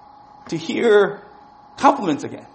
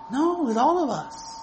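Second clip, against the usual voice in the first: slow earnest; 7 semitones higher than usual. Real, not acted. Sermon climax.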